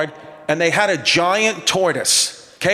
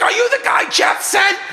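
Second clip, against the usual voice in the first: screaming wife. Retold or imitated. Comic telling the boss's frantic wife yelling from the yard.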